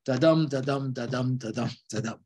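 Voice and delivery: "da-dum" with the DUM stressed each time; iambic beat. Shown as a demonstration. Lecturer sounding out the poem's meter, "da-DUM" in even iambic beats. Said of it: "unstressed and stressed".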